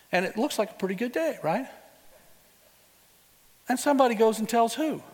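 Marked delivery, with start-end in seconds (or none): none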